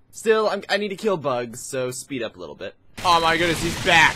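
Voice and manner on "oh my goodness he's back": loud startled alarm. Real, not acted. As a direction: call it out in sudden dismay. Alarmed that the enemy returns.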